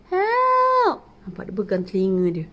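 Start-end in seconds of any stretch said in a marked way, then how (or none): 0.1-1.0 high, loud alien cry; yelling for help. Acted. Alien yelling until a boy hears, high and loud. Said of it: "Alien keep yelling".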